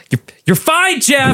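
high-pitched yelling at yourself; mock panicked yelling. Host re-enacting panic attack self-talk, mock yelling. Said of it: "like, start yelling".

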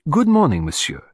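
bright opening, high and loud, then dropping; energetic, businesslike greeting; brisk. Acted. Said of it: "said Poirot briskly".

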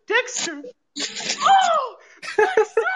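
squealing imitation breaking into laughter; Indy Hartwell. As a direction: shriek the name in hammed-up hysterics. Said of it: "She runs away screaming".

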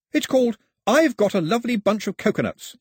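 put-on high, animated young storyteller; bright-eyed explainer, pitched well up; lively Pilou. Pilou names his tune for Mao, high and animated.

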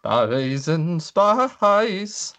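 a hammed-up rock-star croon on request. Shown as a demonstration. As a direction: stretch every word into a crooning impression. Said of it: "Do it again".

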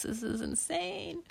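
soft, high, drawn-out disbelief; exasperated parent. Acted. Parents regretting agreeing to panda, exasperated. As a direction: lament it quietly and slowly.

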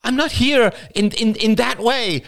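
high, stammering protest; flustered exclamation. A narrator's reading. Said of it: "exclaimed Rachel".

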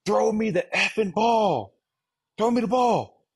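high, mock shouted demand at the sideline; retold sideline yell. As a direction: bark it at the coaches, half acted. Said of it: "I look at our sideline".

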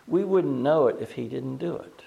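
ordinary delivery

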